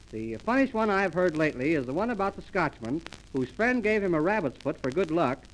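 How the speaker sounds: ordinary delivery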